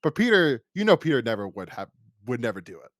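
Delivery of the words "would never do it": usual speaking voice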